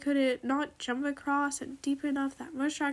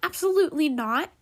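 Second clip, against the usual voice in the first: loud, higher-pitched refusal; shouting back; 5 semitones higher, 7 dB louder than usual. A narrator's reading. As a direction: shoot it back as a firm shout. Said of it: "Shell shouted back".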